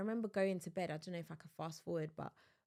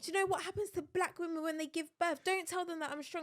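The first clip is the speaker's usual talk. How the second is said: high, animated retold telling-off; emphatic, high-pitched protest; exasperated scolding; 10 semitones higher, faster than usual. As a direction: scold your partner in mock outrage. Retelling her scolding of her partner.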